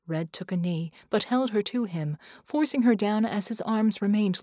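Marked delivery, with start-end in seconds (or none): none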